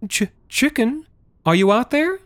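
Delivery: high, stammering call into the dark; girl calls her missing cat, high and worried; anxious child calling. A narrator's reading. Stammering, high call into the night. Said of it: "Madeline cried".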